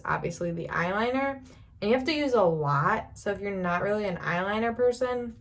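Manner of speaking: usual speaking voice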